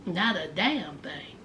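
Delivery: low, quiet deadpan; straight-faced. Retold or imitated. Boyfriend answering how much thought he gave, straight-faced. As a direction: drop it low and soft, no feeling. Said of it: "With a straight face".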